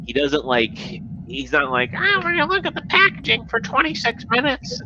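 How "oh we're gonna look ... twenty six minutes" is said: high mocking reviewer voice; mock a rambling reviewer, high-pitched; mocking impression. High mocking voice mimicking a rambling reviewer.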